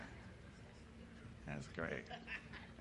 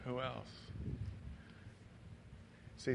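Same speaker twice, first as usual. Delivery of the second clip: normal talking voice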